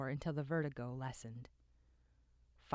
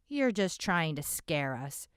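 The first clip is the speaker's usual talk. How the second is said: scoffing; 8 dB louder than usual. Acted. Scoffing listener.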